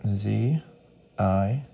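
ordinary talk

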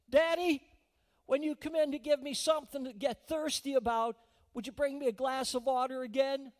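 put-on very loud, high child's holler. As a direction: shout across the house from bed. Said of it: "That little girl hollers out".

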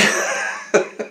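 soft breathy chuckle; real quiet laugh at himself. Real, not acted. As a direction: break into a little laugh. Laughs at own rant.

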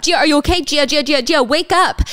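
high, loud acted alarm; urgent waking calls. Rescuer waking her on the ground, urgent alarm. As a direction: call the name repeatedly, trying to rouse someone. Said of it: "trying to get her to wake up".

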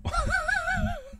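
a burst of giggling at herself; embarrassed little giggle; high-pitched giggle. Real, not acted. Laughing at own mic sound.

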